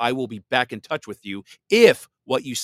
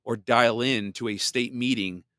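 ordinary delivery

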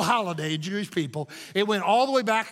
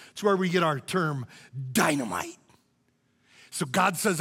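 ordinary talk

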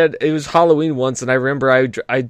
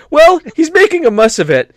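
put-on higher, posh English accent; English accent impression; 11 semitones higher, 4 dB louder than usual. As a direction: do a prim English bloke. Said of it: "he goes".

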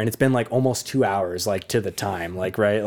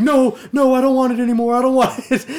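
high, loud pleading no; panicked little brother; 11 semitones higher, 5 dB louder than usual. Retold or imitated. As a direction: beg it away, laughing in panic.

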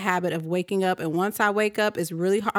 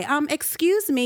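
innocent neighbor; 6 semitones higher than usual. Retold or imitated. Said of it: "play the little innocent neighbor".

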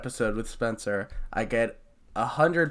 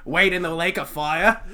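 mock Australian; 4 semitones higher, 4 dB louder than usual. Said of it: "fucking ripper".

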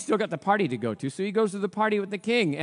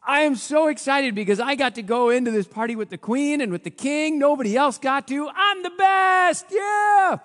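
boastful shout; 10 semitones higher, 5 dB louder than usual. Acted. Haman boasts to his family.

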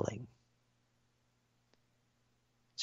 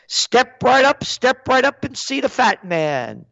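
high, chanting sideshow pitch; old-time circus come-on; circus barker; 10 semitones higher than usual. Acted. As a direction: call the crowd in like a carnival barker.

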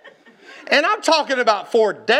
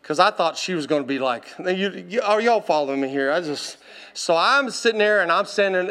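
ordinary delivery

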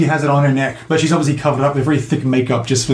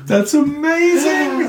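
much higher and slow, stretched out in delight; 13 semitones higher, much slower than usual. Real, not acted. Game master thrilled by the player's daring bluff, high and drawn out. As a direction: savour each syllable, thrilled.

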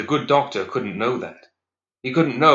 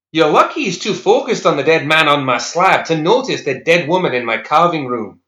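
mocking; 7 semitones higher, 6 dB louder than usual. Douglas mocking her at door.

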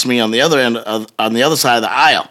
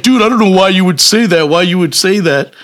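slightly raised, put-on protest; protesting accused man; 6 semitones higher than usual. Accused groper questioning the husband, mildly indignant. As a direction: lightly mimic an offended guy protesting.

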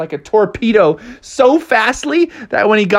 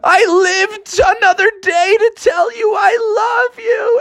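joyful cry; 13 semitones higher, slower than usual. Acted. Flying bear calls to his mom, high overjoyed cry. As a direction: call it out high, near tearful. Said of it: "and he was like".

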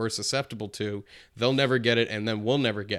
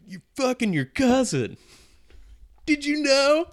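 mock announcer reveal; 6 dB louder than usual.

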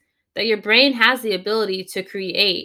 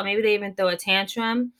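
ordinary delivery

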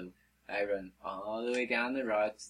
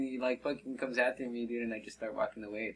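usual speaking voice

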